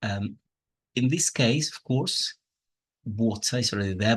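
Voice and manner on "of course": normal talking voice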